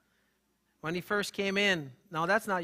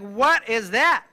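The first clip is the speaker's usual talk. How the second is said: slow incredulous; 4 semitones higher, 10 dB louder, much slower than usual. Retold or imitated. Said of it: "I'm like".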